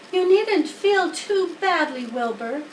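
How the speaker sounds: a lightly voiced wise spider, patient; Charlotte voiced. A narrator's reading. Spider tells the pig he can't spin a web, lightly voiced.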